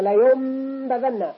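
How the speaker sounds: "ينبذن" said "يمبذن", very slow and drawn out; nun of "لا ينبذن" heard as mim with nasal hold; قلب النون ميما; nun turned to mim. Shown as a demonstration. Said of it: "فتقرأ هكذا".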